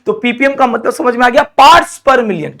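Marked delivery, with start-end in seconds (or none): 1.6-2.6 very slow, drawn out and a bit louder; slow teaching read. Shown as a demonstration. Teacher drilling students on the term, slow and drawn out. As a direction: spell out the term for the class. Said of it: "क्या बोलते हैं इसको?".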